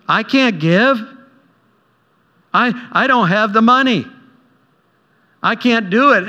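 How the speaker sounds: high, whiny, fretful voice of Philip; panicky Philip. Retold or imitated. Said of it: "You ever get those mind battles?".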